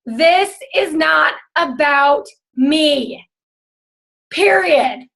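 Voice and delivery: each word laid down very slowly for students to repeat; slow, deliberate mantra. Shown as a demonstration. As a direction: say it slowly like a vow to remember. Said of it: "repeat after me".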